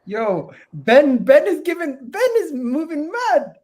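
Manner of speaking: loud disbelief. Real, not acted. Host reacting to Ben's wild prediction, loud incredulous outcry. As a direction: call it out loudly in disbelief.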